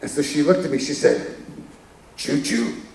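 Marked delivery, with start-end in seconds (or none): 2.2-2.8 whispered little raspy choo-choo; breathy child's rasp, no voice behind it; faint whisper of a small girl; imitating raspy child. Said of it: "with her voice".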